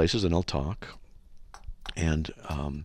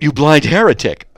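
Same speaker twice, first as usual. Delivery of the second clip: loud insult; 7 semitones higher, 12 dB louder than usual. Pretend. Mock reply to police officer.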